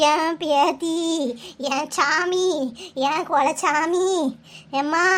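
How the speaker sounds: low, drawn-out cries of grief; keening wail. Acted. Grandmother crying out for her precious granddaughter, keening. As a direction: moan each phrase low, dragging the last syllable.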